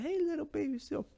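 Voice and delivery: put-on very high sing-out to a baby animal; put-on sweet high voice; mock cutesy greeting. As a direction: coo it in a squeaky falsetto. Voices people approaching baby seals.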